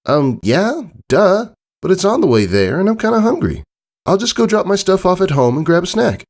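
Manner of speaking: high filly voice, offhand; a kid shrugging off a silly question; young Scootaloo. A narrator's reading. Scootaloo brushing off her friend, high and offhand.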